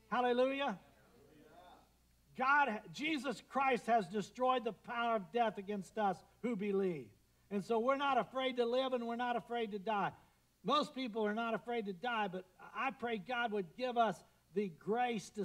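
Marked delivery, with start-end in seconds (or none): 0.1-10.1 high, loud jubilant preaching; hallelujah preaching. Acted. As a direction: ring it out to the church. Preaching at full force.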